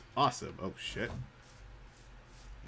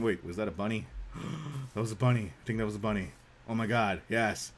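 ordinary delivery

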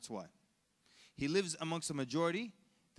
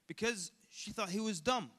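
ordinary talk, nothing marked

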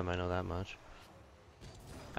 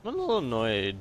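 voice crack; 2 semitones higher, 4 dB louder than usual. Real, not acted. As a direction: voice cracks on annoyed. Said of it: "voice crack".

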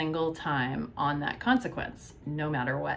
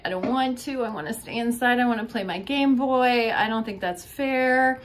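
put-on high, louder whining kid protesting; mimicking a child's protest, high and whiny; 7 semitones higher, 4 dB louder than usual. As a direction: pitch up into a complaining child's whine. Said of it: "And then the child will say".

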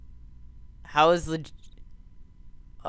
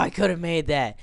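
ordinary delivery

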